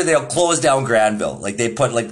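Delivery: ordinary delivery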